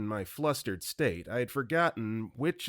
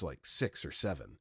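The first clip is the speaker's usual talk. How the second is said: usual speaking voice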